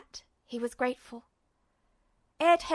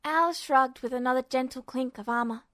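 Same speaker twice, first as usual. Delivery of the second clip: ordinary delivery, nothing marked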